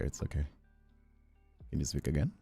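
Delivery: ordinary delivery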